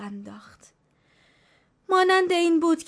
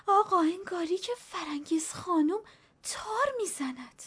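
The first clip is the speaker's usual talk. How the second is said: put-on high, hushed old woman's frightened tale; a hint of fear, higher and dropping to a hush; terrified; 8 semitones higher than usual. A narrator's reading. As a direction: tell it as if the ghost might hear. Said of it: "با حالت وحشت زده گفت".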